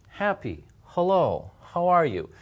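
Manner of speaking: light English h in "Happy, hello, how are you". Shown as a demonstration. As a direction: say "Happy, hello" with just a little air on the h. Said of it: "it's very light".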